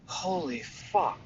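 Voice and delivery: stunned hush. Retold or imitated. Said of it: "I was just like".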